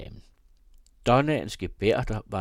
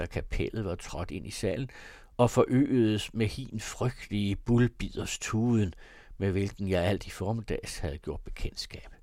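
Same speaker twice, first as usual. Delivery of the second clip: ordinary delivery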